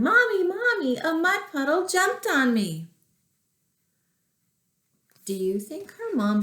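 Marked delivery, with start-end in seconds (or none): 0.0-2.9 yelling. A narrator's reading. Muddy girl yelling to her mother again. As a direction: wail up high for mommy.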